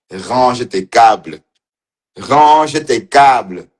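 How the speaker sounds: Range tes câbles dragged out slowly, like a nagging parent; slow mock scold. Teasing the woman on camera, slow mock scolding. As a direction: spell it out slowly, mocking.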